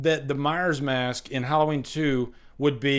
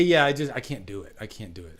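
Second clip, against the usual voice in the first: ordinary talk, nothing marked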